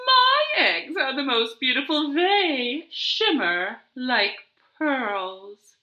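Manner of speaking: lightly voiced boastful hen; proud hen. Dot bragging to Zinnia, lightly voiced proud hen. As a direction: preen a little as you say it.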